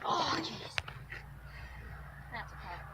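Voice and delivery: unvoiced tired sigh; whispered sigh. Real, not acted. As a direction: breathe it out, spent. Worn out between attempts.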